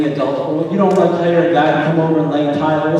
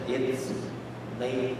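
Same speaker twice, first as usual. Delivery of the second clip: very quiet, measured wife imitation; understated and barely voiced; hushed insistence; 3 semitones lower, 16 dB quieter than usual. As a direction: repeat it softly, pausing before the last word. Wife repeats the news.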